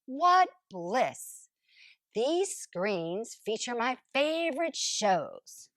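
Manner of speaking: usual speaking voice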